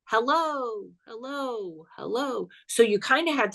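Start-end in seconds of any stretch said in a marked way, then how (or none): 0.1-0.9 first "Hello" said clear and a little higher; reverb echo demo. Shown as a demonstration. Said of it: "it would go like this". 1.1-2.5 "hello, hello." repeated much softer, dying away like reverb; fading reverb echo. Shown as a demonstration. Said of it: "it would go like this".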